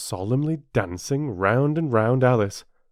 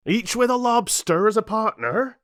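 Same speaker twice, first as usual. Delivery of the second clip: higher, louder, excited cry; Griffin cries out; 12 semitones higher, 6 dB louder than usual. A narrator's reading. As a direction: call it out with excitement. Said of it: "cried the Griffin".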